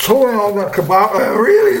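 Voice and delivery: high mock whine; whiny complainer. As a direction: whine like a picky customer. Imitating complaining people.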